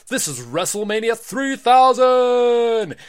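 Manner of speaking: high, loud, slow arena announcer call; announcer shout. Acted. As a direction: stretch it out for the crowd. Boy announces the show.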